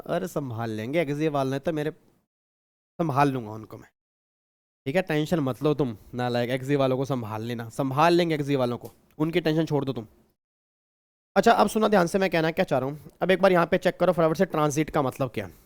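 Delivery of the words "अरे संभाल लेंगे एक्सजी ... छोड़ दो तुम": low, soft, unbothered aside; offhand reassurance. Real, not acted. Teacher telling a student not to worry, low and offhand. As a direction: brush the worry off quietly, voice dropped.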